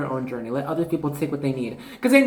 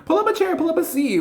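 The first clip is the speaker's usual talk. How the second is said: excited welcome. Retold or imitated. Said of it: "I'm like".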